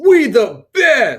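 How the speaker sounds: high, slow bragging shout; boastful shout. Acted. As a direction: yell it like a hype man.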